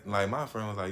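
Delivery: ordinary talk, nothing marked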